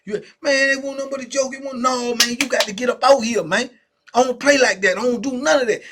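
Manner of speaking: heated warning. Retold or imitated. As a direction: order him out of the cell. Celly warns him to leave.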